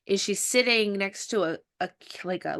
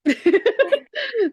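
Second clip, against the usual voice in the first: high, loud delighted laugh; loud burst of laughter; 7 dB louder than usual. Real, not acted. Host reacts to passion painting.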